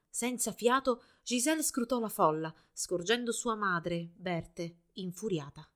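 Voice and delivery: ordinary delivery, nothing marked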